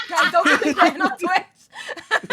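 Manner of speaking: loud, slow laughing call; laughing shout. Real, not acted. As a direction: laugh it out to the stream.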